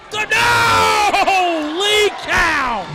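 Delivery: loud, drawn-out disbelief; shocked yell. Real, not acted. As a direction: roar at the kickout. Pile driver kickout.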